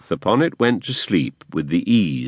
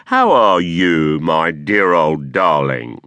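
slow, louder false tenderness; mock affection; 3 semitones lower, 3 dB louder, slower than usual. A narrator's reading. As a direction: coo with fake fondness. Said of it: "in a tone of great affection".

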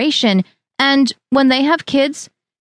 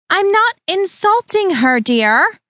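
put-on high, sweetly evasive older woman; evasive Harriet; 9 semitones higher, slower than usual. A narrator's reading. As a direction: brush off the charge with a high, false sweetness. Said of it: "Harriet said evasively".

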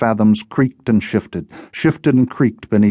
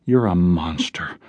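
slow, cold condemnation; cold accusation; 3 semitones lower, 3 dB quieter, slower than usual. A narrator's reading. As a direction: draw it out coldly. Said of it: "She looked at him coldly".

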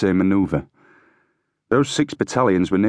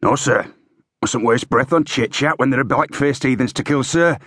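higher-pitched sergeant's reply, rising on sir; stiff, raised-pitch military reply; parade-ground sergeant; 6 semitones higher than usual. A narrator's reading. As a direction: answer the officer like a drill sergeant. Sergeant answers officer in parade style.

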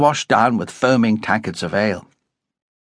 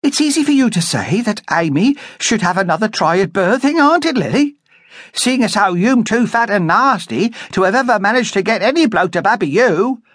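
barmaid picking a fight; 11 semitones higher than usual. A narrator's reading. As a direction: fire back to defend Amy. Barmaid defending her friend.

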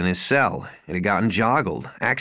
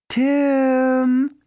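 mother's voice; 13 semitones higher, 3 dB louder than usual. Acted. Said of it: "Hi, Mom".

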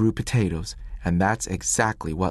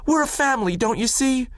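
pleading shout. A narrator's reading. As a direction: fight to keep the family together. Willie protests being separated.

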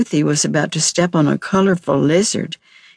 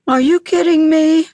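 high, louder mock snarl at his friends; 12 semitones higher, 3 dB louder than usual. A narrator's reading. Rex snaps at friends inviting him fruit picking, mock snarl. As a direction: sneer it out, trying to sound tough. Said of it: "Rex snarled to his friends".

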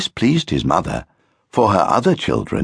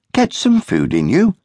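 mother; 13 semitones higher than usual. Acted. Mother urging.

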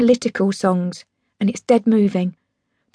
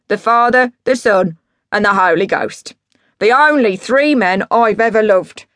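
put-on drunk boastful dad; 5 dB louder than usual. Drunk dad on his three musical idols, grandiose. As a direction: preach your heroes like a grandiose drunk.

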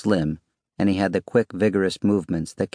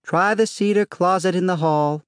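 high, casual call-back; tossed over shoulder; 12 semitones higher than usual. A narrator's reading. Wife walking away from husband, calling over her shoulder. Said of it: "flinging over her shoulder".

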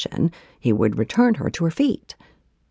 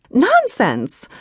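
indignant scoff; 12 semitones higher, 4 dB louder than usual. A narrator's reading. Heroine scoffs.

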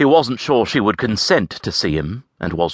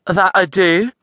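high, lilting Scots lass; Avril's voice; 12 semitones higher, 3 dB louder than usual. A narrator's reading. Scottish lass answering the stranger. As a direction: voice the saucy Scottish farm girl.

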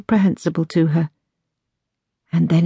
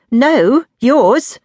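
high, louder comeback; swift retort; 8 semitones higher, 5 dB louder than usual. A narrator's reading. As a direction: cut her off with a firm retort. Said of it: "Dulcie shot back swiftly".

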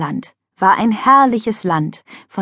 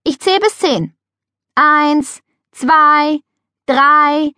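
high, louder counting; 7 semitones higher, 5 dB louder, much slower than usual. A narrator's reading. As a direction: count out to the others in the pool. Counting aloud in tag.

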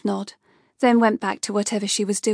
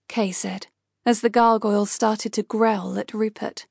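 ordinary delivery, nothing marked

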